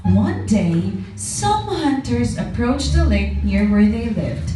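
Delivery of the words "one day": ordinary delivery, nothing marked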